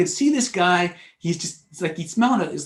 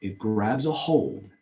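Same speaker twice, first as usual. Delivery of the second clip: slow emphasis; 4 semitones lower, slower than usual. Real, not acted. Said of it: "slow closing point".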